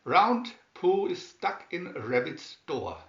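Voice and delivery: usual speaking voice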